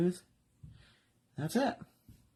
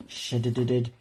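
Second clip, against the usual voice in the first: mock correction; 5 semitones lower than usual. Real, not acted. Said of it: "Sheds is the right word".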